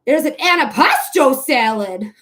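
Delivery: loud, hammed-up exclamation; Mister Pearl Mania impression. Streamer quoting a funny salad line, hammed-up impression. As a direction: belt it out with exaggerated emphasis. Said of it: "Just like Mister Pearl Mania".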